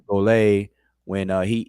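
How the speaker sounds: ordinary talk, nothing marked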